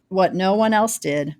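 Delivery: ordinary delivery, nothing marked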